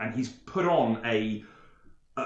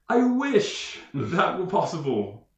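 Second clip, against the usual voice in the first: longing courtier; 2 semitones higher than usual. Acted. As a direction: sigh it out like a trapped suitor. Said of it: "he said".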